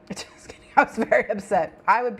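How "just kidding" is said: laughing whisper. Real, not acted. Giggled aside after a joke.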